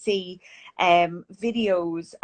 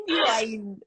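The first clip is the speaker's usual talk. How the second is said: genuine said in an Irish accent. Shown as a demonstration. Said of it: "trying to do this in Irish accent".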